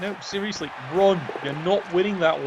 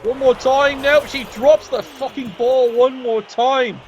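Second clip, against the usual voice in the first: raised, louder, drawn-out exasperation; exasperated call; 5 semitones higher, 6 dB louder than usual. Real, not acted. Commentator watching the ball dropped yet again, exasperated. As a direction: lament the fumble, higher and slower.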